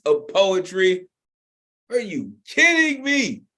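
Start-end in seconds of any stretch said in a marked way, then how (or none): 1.9-3.4 high-pitched, delighted incredulity; real impressed disbelief; amazed disbelief. Real, not acted. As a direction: exclaim it high, as if floored. Host marvels at the poem.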